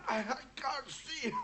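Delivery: coughing, strained gasps; choking in smoke. Acted. The smoked-out man chokes and splutters in fear. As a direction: gasp through the smoke.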